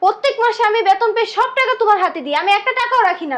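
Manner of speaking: high-pitched indignant pleading; hurt protest. Acted. Wage earner to sister-in-law who takes it all, hurt protest.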